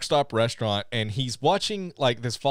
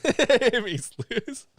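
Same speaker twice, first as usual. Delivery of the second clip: normal talking voice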